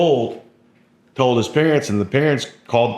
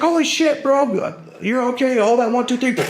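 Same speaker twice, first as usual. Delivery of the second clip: much higher, urgent and excited; frantic doctor. Retold or imitated. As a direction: shout the count as you rush to pull the tube. Doctor rushing to extubate.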